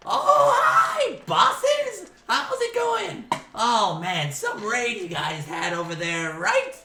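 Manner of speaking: very high, loud goblin squeal; loud, squeaky goblin lackey; chummy goblin. Acted. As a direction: greet your bosses in an over-eager falsetto. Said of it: "and he says".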